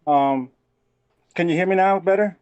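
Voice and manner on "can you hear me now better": normal talking voice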